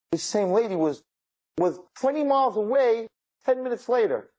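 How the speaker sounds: high-pitched, emphatic amazement; amazed climax. Real, not acted. Storyteller revealing the lady was miles away, amazed and high-pitched. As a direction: build to a high, incredulous punchline.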